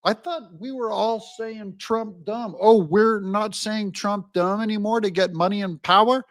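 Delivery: high, baffled acted voice; mock bewilderment. Democrats puzzled the slogan stopped working, mock bewilderment. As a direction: play dim and caught off guard. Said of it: "and they're all".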